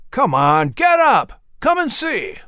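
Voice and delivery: high, loud eager shout of get up; calling his brother to come see, bursting with excitement; pulled-up, high excited cry; excited urging. Acted. Pulling brother to his feet.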